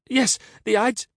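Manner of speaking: high, loud, excited echo; eager cry. A narrator's reading. Caesar echoing the beggar's omen, high eager cry. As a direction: pounce on it in a high voice.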